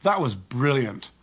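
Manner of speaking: slow, falling sarcastic quip; annoyed sarcasm. Shown as a demonstration. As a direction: stretch it out with dry annoyance. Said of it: "with a tonality that communicates annoyance".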